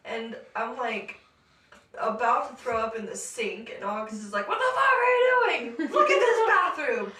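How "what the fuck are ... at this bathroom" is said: high, loud yelling; mimicked boyfriend exclaiming at the top of his voice; retold shocked outburst. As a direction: holler in disbelief, hammed up.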